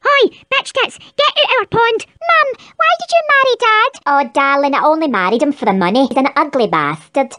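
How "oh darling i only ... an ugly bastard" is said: deep, growling Scottish mum; gruff low mother voice. Acted.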